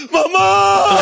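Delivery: put-on crying baby.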